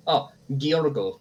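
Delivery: put-on Giorgo drawn out slowly with a foreign accent; a hint of a foreign accent on Giorgo. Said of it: "He says it with an accent".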